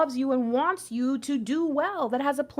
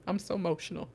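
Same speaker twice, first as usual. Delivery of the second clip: "I'm so emotional" repeated much softer, half to herself; softly emotional; 6 semitones lower, 9 dB quieter, faster than usual. Real, not acted. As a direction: let it sink in quietly, touched.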